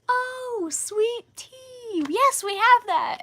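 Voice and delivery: high, bright-voiced sudden understanding; relieved realization. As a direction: pitch way up as it finally clicks. Worker finally understands.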